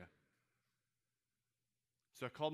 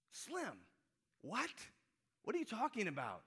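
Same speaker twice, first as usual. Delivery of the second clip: high, incredulous squawk; mock bewilderment, pitched way up; baffled protest; 9 semitones higher, 4 dB louder than usual. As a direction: question your buddy in high-pitched disbelief. Acting out his baffled reply.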